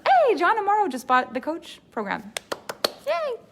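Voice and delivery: hammed-up cheerleader; mock cheer. Coach parodies a boastful sales post, peppy and high.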